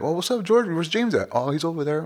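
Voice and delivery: mimicking people asking. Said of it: "I'm like".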